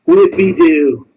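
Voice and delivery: slow, drawn-out mock celebration; mock cheer. Real, not acted. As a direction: stretch it out like a sarcastic hooray. Mock cheer at paying small sum.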